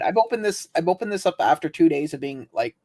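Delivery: normal talking voice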